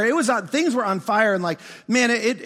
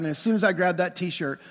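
ordinary talk, nothing marked

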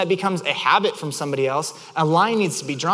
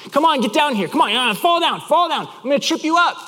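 higher-pitched goading voice; taunting; 6 semitones higher than usual. Acted. Offending things goading the listener to fall, taunting. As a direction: egg them on to fall.

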